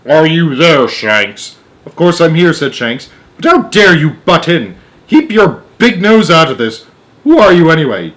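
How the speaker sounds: bristling at the intruder; indignant astronaut. A narrator's reading. Shanks protests the interruption, indignant and loud.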